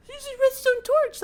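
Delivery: very high, loud falsetto alarm; mock panicked squeal. Retold alarm to his cousin.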